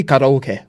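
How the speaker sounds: "karaoke" said the Japanese way, ka-ra-o-ke with short pure vowels; "karaoke" with a tapped r and a short final "keh"; Japanese pronunciation. Shown as a demonstration. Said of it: "no English person is gonna understand you".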